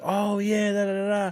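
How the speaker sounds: high-pitched imitation of a woman remembering; delighted recognition. Woman recognizes him in retold reunion.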